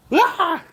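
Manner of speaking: sudden high-pitched shriek; startled high yelp. Real, not acted. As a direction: yelp in fright. Bird flies at him.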